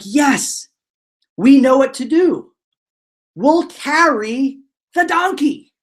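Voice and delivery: high, emphatic mock eureka; hammed-up excited discovery; acted triumph. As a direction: announce the bright idea, stretching the last words. Acted triumphant idea in story.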